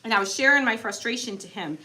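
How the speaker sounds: normal talking voice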